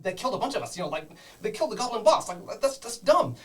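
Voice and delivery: high, fast, squeaky kobold arguing back with the boss; nervous kobold underling. Acted. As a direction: protest in a high, hurried creature voice. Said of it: "I'm like".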